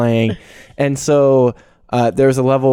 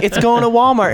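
pitched up, playing it confident; higher, mock sure answer; 9 semitones higher than usual. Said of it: "I was like".

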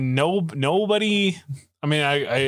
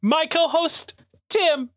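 grand entrance announcement; 8 semitones higher than usual. Acted. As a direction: boom it like a ring announcer. Announcer-style show intro.

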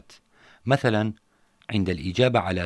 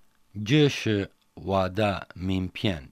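slow repeat after teacher; much slower than usual. Shown as a demonstration. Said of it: "يمكنك أن تقول".

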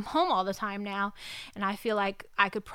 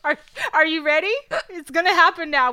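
rallying the dog; 6 semitones higher, 7 dB louder than usual. Pretend.

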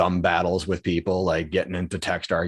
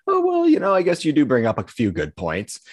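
starts very high and louder, then eases down; mock easily swayed; 8 semitones higher, 5 dB louder than usual. Former self swayed by others, high mock concession. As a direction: act out giving in, in a high put-on voice. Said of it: "I used to be swayed by others".

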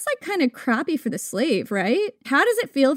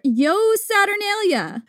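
drawn-out, high sing-out cry; mock festive shout; 7 semitones higher, slower than usual. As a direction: hail the street like a reveler. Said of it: "People would shout to each other".

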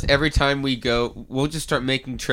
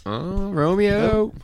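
drawn-out greeting; 8 semitones higher, much slower than usual. Pretend. Greeting viewer in sung drawl.

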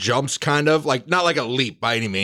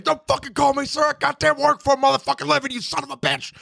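higher shouted parting insult; yelling after him; 12 semitones higher than usual. Acted. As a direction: shout it at his back as he runs. Yelling after the fleeing private.

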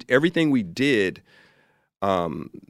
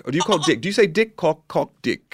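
high and loud, crude demands; the director pushing the actor, louder and higher; pushy English director; 7 semitones higher, 5 dB louder than usual. Retold or imitated. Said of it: "there is a director from England".

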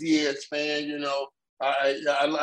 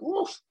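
wincing oof; 4 dB quieter than usual. Retold or imitated. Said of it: "I was like".

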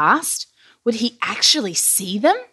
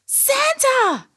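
very high, drawn-out squeal; delighted shout. A narrator's reading. Billy sees Santa arrive, high delighted cry. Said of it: "Billy shouted in delight".

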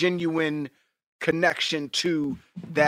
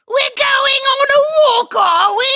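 high, loud, whiny mock British shout; whiny British accent; 10 dB louder than usual. Showing the only British accent he can do, whiny mock British. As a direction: squeal it like the whiny Harry Potter kid. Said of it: "That's the only British accent I can do".

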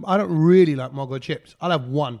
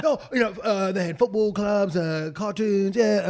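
imitated salesman pitch; 3 semitones higher, slower than usual. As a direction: imitate a friend selling his hobby. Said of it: "like, he's trying to sell it to me".

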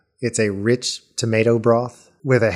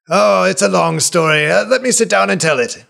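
put-on high, loud, delighted old man; overjoyed to meet an old friend; excited old healer; 9 semitones higher, 3 dB louder than usual. GM NPC voice continues.

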